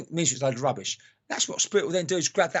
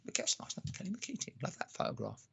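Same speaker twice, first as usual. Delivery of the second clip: soft aside; 3 semitones higher, 13 dB quieter than usual. Real, not acted.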